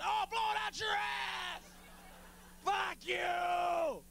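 high, screaming rage; hammed-up furious protester screaming; furious shout. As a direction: scream it at the president. Acted furious protester yelling.

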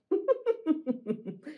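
acted high, chuckling laugh; short staccato story laugh; crowd laughing. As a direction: laugh for the onlookers. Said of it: "They're all laughing".

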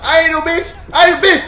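high, loud defiant yell; loud defiant shout; loud boast. Acted. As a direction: yell it up high to pump yourself up. Hyping himself for a fight.

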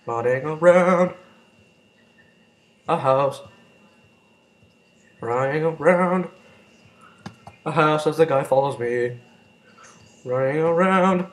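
loud, slow dramatic drawl; slow stretched narration. Real, not acted. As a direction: stretch each word while fleeing. Fleeing an attacker in game.

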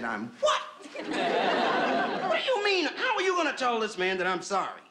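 drawn-out high comic outrage; outraged disbelief. Acted. Lulu turns on her passenger for apologizing, hammed-up outrage. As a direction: explode in affronted disbelief, then argue it down.

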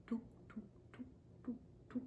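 whispered, tiny tiptoe steps. Acted. Reading to a child, whispering the tortoise's tiptoe steps. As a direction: breathe it out in a whisper, sneaking.

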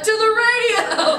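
high, loud, drawn-out battle cry; hammed-up triumphant group cheer; rallying cry. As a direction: shout it like a crowd charging off. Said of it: "twenty-nine other full-grown adults were like".

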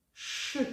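a whispered, hissing Ш; «Ш» as a long, voiceless hiss; sounding out. Shown as a demonstration. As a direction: hiss «Ш» without voice. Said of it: "І дивимось".

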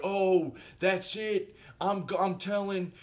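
high-pitched, fed-up imitation; imitating her snapping. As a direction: act out someone storming off upset. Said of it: "And then she snapped".

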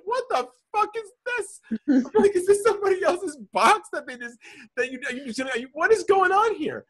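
high, squeaky mock outrage, half laughing; retold shock at getting someone's old box; outraged disbelief. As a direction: play the stunned customer in a shrill high voice.

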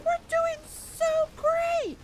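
sing-song denial of disaster; mock falsetto. Player mocking his own optimism while troops die, falsetto. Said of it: "I'm just like".

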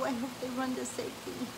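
plain reading voice

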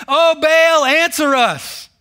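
loud, high cry, then a slow drawn-out plea; hammed-up begging to an idol; pleading prophets. Preacher mimics Baal's prophets crying out for an answer, hammed up.

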